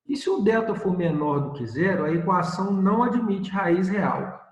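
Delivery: normal talking voice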